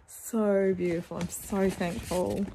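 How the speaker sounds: normal talking voice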